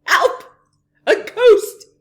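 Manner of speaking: very high, very loud yells; shouted. A narrator's reading. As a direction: scream it out in panic. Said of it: "the parrot shouted".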